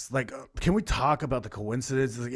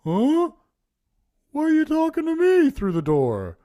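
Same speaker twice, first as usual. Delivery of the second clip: much higher, louder, baffled put-on voice; Charlie's reply; 7 dB louder than usual. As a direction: answer like a confused man behind the door. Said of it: "And then he's just like".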